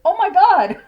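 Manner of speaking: high, loud "oh my God" in disbelief; shocked gasp. Retold or imitated. Reacting to the text that Mom passed away, shocked and loud. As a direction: blurt it out, stunned. Hearing news of mom's death.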